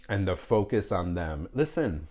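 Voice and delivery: ordinary delivery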